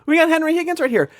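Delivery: high-pitched mock-excited announcement; excited retort. Host retelling a reaction to a parlor trick, high and excited. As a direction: call it out high, as if spotting him. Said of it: "And I'm like".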